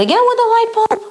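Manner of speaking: high, fussing mother waving off the bulb; mother steering son away from the chore; doting mother. Retold or imitated. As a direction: brush it off and coddle him. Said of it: "She says".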